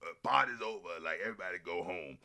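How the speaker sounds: a hint of Delroy Lindo sending guests home; imitating Delroy Lindo. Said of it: "Delroy Lindo has to be like".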